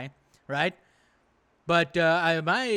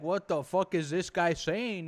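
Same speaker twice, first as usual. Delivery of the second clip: slower, lightly voiced Piers Morgan, baffled; mock baffled Piers. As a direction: drag it out like a lost host. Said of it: "Piers Morgan is like".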